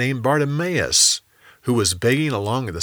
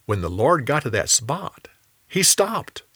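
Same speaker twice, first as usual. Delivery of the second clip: ordinary delivery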